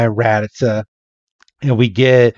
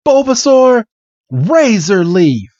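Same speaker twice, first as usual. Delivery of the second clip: acted attack order; battle command; 8 semitones higher than usual. Red ordering Bulbasaur to attack, commanding.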